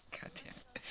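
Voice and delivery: breathy whispered swear under the breath; a quick whispered God damn it; whispered curse. Real, not acted. Muttered aside mid-story.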